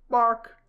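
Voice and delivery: high loud barking yelp; loud mock dog bark.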